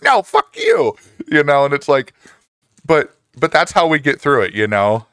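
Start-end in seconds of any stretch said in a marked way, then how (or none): 0.0-1.0 very high, playful comeback; squeaky-high mock outrage; mock retort. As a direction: fire the joking insult right back. Acted mock couple's spat.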